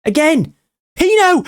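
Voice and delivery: watching his striker shape to shoot, cheering; rising excitement. Real, not acted. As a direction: urge the play on, voice leaping up. Chance building in the box.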